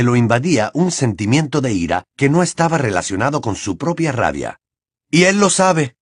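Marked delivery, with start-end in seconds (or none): 5.1-6.0 higher-pitched taunt; Harry taunting. A narrator's reading. Harry taunting Bellatrix about her master, gloating. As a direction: throw it at your enemy, pitched up. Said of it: "añadió Harry, soltando una risotada".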